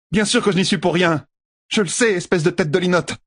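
voix aiguë de sage-dame vexée; Nineveh piquée. A narrator's reading. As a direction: rembarrer Perrine. Nineveh répond sèchement. (la sage-dame répond sèchement à Perrine)

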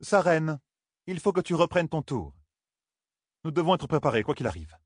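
lightly voiced preacher, pitched high; a touch of high-pitched sermonizing; mild preacher tone. A narrator's reading. Coiren urges her companion to be prepared, mildly preachy. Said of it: "de son ton de prédicatrice en chair".

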